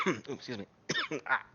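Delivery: coughing, choking, strained. Real, not acted. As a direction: talk after choking. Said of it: "I'm choking on my finger".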